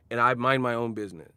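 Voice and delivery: ordinary delivery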